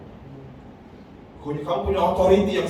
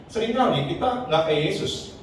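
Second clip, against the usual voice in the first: usual speaking voice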